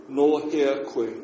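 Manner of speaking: No hea koe said at half pace; slow Māori phrase. Shown as a demonstration. Teacher teaching where are you from. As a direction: model each word slowly. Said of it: "teacher modelling the phrase".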